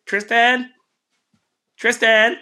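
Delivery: high, loud call of a woman searching the house; calling out for Tristan. Retold or imitated. Woman calling her son's name through a stranger's porch. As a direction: sing out the name like a mother looking for her kid. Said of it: "she hears this woman's voice".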